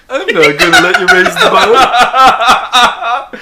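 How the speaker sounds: high, slow, loud and laughing; laughing cartoon voice. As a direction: drag it out high while laughing. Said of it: "And he's like".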